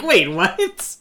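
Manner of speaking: high, loud double-take; mock bewilderment. Host reacts to sudden French noises, loud bewilderment. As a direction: blurt it out in confusion. Acting out confusion at the accent.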